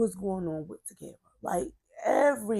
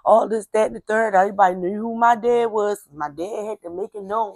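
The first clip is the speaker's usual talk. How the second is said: ordinary delivery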